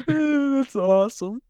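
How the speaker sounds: high, slow words through laughter; shocked laughter. Real, not acted. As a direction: crack up in disbelief. Cracking up at the shirt.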